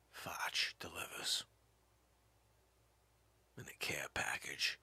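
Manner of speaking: low hushed whisper; whispered aside. Acted. Comic telling the audience, whispered. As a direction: whisper it like a secret.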